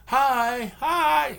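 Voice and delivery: very high, loud squealed hi; bubbly girls' hi. Retold or imitated. Imitating movie girls greeting, high squealed hi. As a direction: squeal it like a teen girl at a party. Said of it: "from the girls at first".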